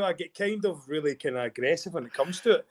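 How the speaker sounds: ordinary talk, nothing marked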